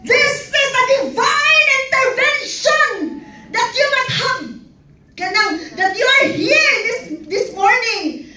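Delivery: high, slow preaching voice; hammed-up evangelist announcing divine intervention; mock preacher. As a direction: imitate the speaker's big stage preaching. Speaker's preaching voice imitated.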